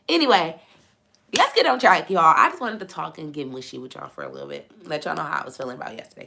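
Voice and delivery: ordinary talk, nothing marked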